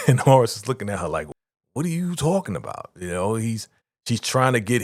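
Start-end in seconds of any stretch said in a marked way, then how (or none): 1.7-2.9 higher, incredulous; mock baffled. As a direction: act thrown by the question. Horace voiced, baffled.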